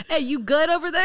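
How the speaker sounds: laughing mock server voice. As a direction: giggle the table check-in.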